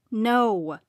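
firm, stern refusal. A narrator's reading. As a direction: put your foot down. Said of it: "Cody said sternly".

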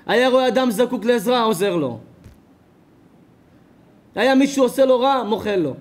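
loud, high preacher's cadence, each clause hammered; preaching at full force. Acted. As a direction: preach it to the whole hall. Preacher's rhetorical list.

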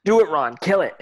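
urgent command. Acted. Harry urges Ron.

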